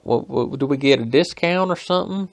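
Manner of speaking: high, loud Southern coworker voice; put-on high Alabama twang; high-pitched twang. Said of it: "one guy's got a higher pitched".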